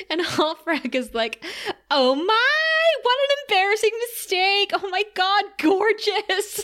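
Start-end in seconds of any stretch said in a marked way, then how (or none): 1.9-6.6 very high, gushy mock squeal; gushing delight. Halfrack admires her friend's engagement ring, high gushing. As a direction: coo and fawn in an exaggerated high voice.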